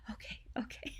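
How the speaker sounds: whispered, shaky okay okay. Retold or imitated. New mom answering the nurse, whispered and tearful. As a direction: breathe it out softly, still tearful.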